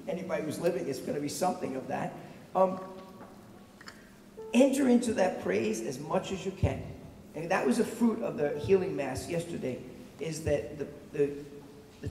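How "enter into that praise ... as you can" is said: usual speaking voice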